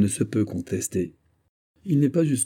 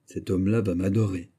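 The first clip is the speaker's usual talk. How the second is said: ordinary delivery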